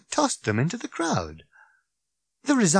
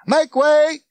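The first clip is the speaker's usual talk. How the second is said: high, loud megaphone shout; full-force shouted command; bawling; 8 dB louder than usual. A narrator's reading. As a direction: clear the crowd with a yell. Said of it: "bawling at the crowd through it".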